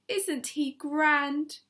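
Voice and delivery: slow, admiring question; proud. A narrator's reading. Missus Silver admiring her pet, proud. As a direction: savour the pride. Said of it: "Missus Silver said proudly".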